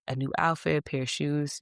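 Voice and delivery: normal talking voice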